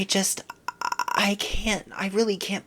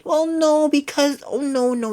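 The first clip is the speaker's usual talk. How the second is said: high, loud fussing protest; hammed-up fluttery denial; mock reassurance; 9 semitones higher, 5 dB louder than usual. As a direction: gush it out like a worried friend. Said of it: "people to be like".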